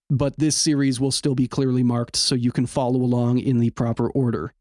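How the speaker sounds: ordinary talk, nothing marked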